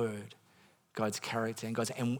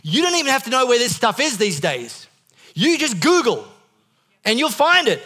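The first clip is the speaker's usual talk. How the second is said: much higher and louder, ringing preacher's voice; preaching at full force; 10 semitones higher, 12 dB louder than usual. Acted. Pastor rebutting the devil's lie to the congregation, full-force preaching. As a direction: proclaim it to the whole church.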